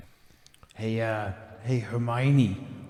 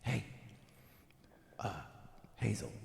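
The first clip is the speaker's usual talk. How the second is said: hushed whisper aside; 3 semitones lower, 15 dB quieter than usual. Real, not acted. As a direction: whisper it to your friend.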